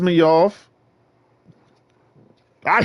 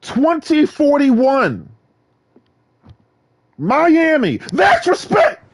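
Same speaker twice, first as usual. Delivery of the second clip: excited boasting; 12 semitones higher, 4 dB louder, slower than usual. Real, not acted. Hyped boasting about race car.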